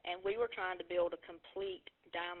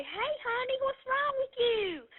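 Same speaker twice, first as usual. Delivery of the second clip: sweet friend's voice; 6 dB louder, slower than usual. Retold or imitated. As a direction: coo it like a caring friend. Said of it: "And Tina was so sweet".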